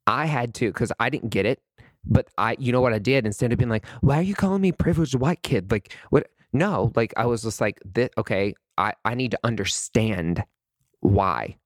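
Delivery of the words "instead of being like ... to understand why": ordinary delivery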